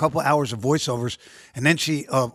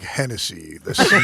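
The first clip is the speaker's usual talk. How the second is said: smooth ad voice; 5 semitones lower, slower than usual. Retold or imitated.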